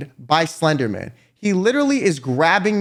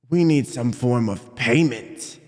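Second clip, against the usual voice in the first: slow, lowered tough-guy voice; low menacing demand; 7 semitones lower, slower than usual. Retold or imitated. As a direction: imitate a slow, deep shakedown. Threatening man demands money in story.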